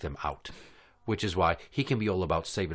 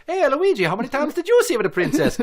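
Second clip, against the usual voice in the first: high, loud, fast mock Italian taunt; Mario voice; 6 dB louder, faster than usual. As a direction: tease Luigi like a cartoon plumber.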